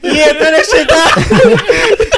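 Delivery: high, drawn-out mock gangster heckle. Friend imagining a gangster climbing out the trunk, mock threat. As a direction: play the trunk straggler, laughing.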